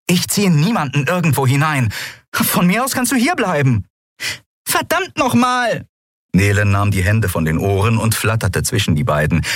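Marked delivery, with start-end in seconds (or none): 0.1-3.9 high, heated retort; angry quarrelling. A narrator's reading. Captain telling Keru he can stay, angry. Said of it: "hört ihr nicht endlich auf zu brüllen". 4.7-5.8 slow, very high outcry; exasperated Nelen. A narrator's reading. Nelen breaking up the quarrel, exasperated high cry. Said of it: "hört ihr nicht endlich auf zu brüllen".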